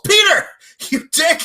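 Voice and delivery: very high, loud mock outrage; exasperated shout. Viewer scolds Peter for calling mid-scene, exasperated shout. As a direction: yell at him, breaking into laughter.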